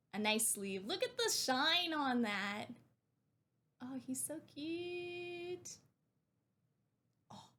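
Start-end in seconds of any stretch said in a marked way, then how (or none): 3.8-7.5 slow, soft, high coo. Real, not acted. Cooing over the card.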